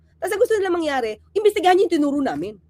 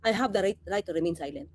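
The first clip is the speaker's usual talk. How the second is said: much lower, much softer smug refusal; mock evasive complainant; 8 semitones lower, 8 dB quieter than usual. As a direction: mutter it low like a stonewalling suspect. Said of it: "ano po ang reklamo nyo?". (mahina at mababang pag-iwas)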